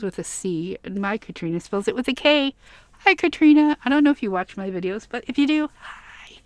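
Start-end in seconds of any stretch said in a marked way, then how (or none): 5.8-6.4 voiceless, very soft greeting; voice giving out. Real, not acted. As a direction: let the word fail into bare breath. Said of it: "I'm losing my voice".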